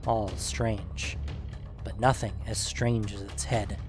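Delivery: usual speaking voice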